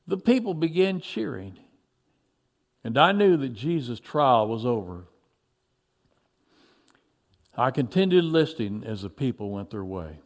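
plain reading voice

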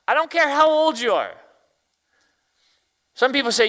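loud, high exhortation; preaching. Real, not acted. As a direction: drive it home to the congregation.

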